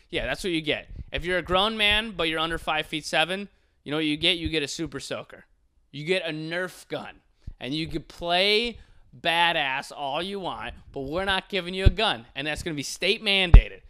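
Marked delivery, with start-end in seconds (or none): none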